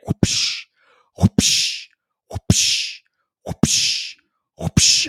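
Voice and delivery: breathy whip swish sound, repeated; unvoiced swishing whip noises; weak whip swish. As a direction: mimic a feeble belt swish. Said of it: "She'd be like".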